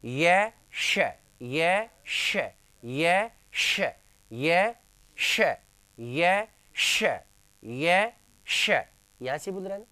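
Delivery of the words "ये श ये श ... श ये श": long ये sweeping upward, then a crisp hushed श, repeated; repeated sound drill. Shown as a demonstration. Teacher repeating five times to place the tongue, drilled ये श. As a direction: chant ये श with rising ये each time. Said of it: "पांच बार बोलेंगे तब जाके पहुंचेंगे".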